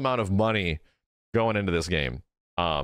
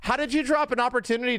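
higher, worked-up disbelief; exasperated rant; 9 semitones higher than usual. Real, not acted. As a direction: rant at the missed moment. Ranting about missed chant.

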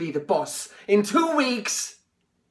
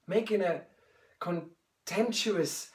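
softer, inward questioning; quiet; 5 semitones lower, 9 dB quieter than usual. Acted. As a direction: ask yourself in a hush. Self-questioning, hushed.

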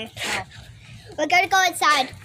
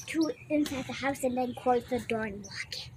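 unvoiced whisper; 7 semitones lower, 11 dB quieter than usual. Real, not acted. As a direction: whisper the secret plan. Secret plan to lock door.

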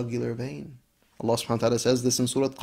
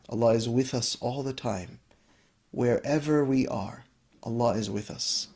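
usual speaking voice

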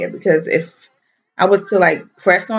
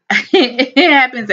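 laughing; 7 semitones higher, 4 dB louder, slower than usual. Real, not acted. Laughing about embarrassing gas.

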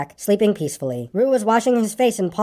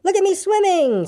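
high little squeaky voice, showing off; very high proud squeak; 12 semitones higher than usual. A narrator's reading. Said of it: "squeaked Roo".

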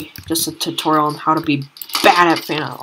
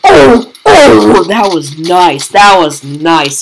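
thrilled shouting; 3 semitones higher, 13 dB louder, slower than usual. Real, not acted. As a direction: shout in triumph at the shot. Celebrates landing a shot.

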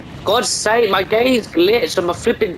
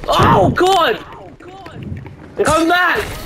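high panicked shout; panicking gamer; 10 semitones higher, much slower than usual. Real, not acted. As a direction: yell it in a panic. Fight going wrong, shouting.

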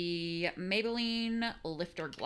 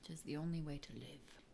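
whispered theatrical musings; mock dramatic whisper; 6 dB quieter than usual. Vlogger whispers grand quotes to herself in mock drama. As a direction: hush it like a pompous poet. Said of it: "Quoting things again".

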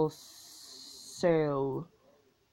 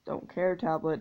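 ordinary delivery

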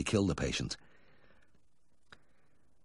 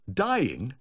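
startled query; 7 semitones higher than usual. Acted. Vet questions alarming report.

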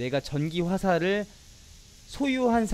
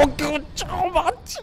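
excited; 13 semitones higher, slower than usual. Real, not acted. Excited after the win.

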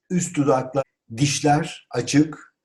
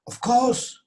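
high-pitched eager assent; exclamation; 11 semitones higher than usual. Shown as a demonstration. Teacher models agreeing readily, exclaimed. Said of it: "ünlem demek haykırmak demek".

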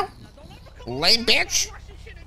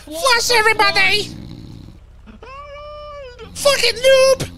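taunting shout; 6 semitones higher than usual. Real, not acted. Taunting after kill.

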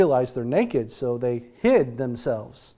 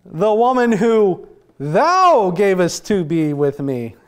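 higher, louder, slow accusing whine; whiny blaming Adam; 4 semitones higher, 5 dB louder, slower than usual. Retold or imitated. Adam blaming God for the woman, in a slow whining accusation. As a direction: point the finger at God with a drawn-out complaint. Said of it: "the first words out of his mouth is".

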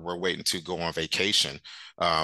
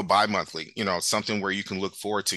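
ordinary talk

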